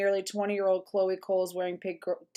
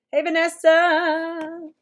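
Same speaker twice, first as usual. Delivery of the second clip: higher, louder, slow drawn-out hello; calling out a greeting; 9 semitones higher, 7 dB louder, much slower than usual. Real, not acted. Streamer greeting a chat viewer, higher, louder, slow and stretched. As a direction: sing out the name to welcome her.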